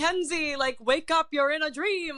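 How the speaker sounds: play-call someone awake from a dream; comic bit retold.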